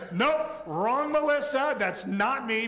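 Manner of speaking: jokey alarmed denial; mock panicked refusal. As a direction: blurt it out to wriggle away. Imagined panicked reply to angel.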